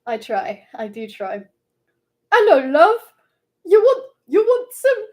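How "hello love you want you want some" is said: high, loud British character voice; actually British accent. Acted. Streamer resuming the impression, too genuinely British, high and loud. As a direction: call it out in a pitched-up British accent. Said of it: "I'm doing it, like, actually British".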